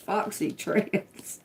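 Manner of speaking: high, breaking into laughter; laughing. Real, not acted. Reader tickled by the name found in the letters, laughing. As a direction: say it slowly through a soft laugh.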